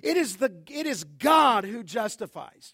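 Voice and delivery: ordinary delivery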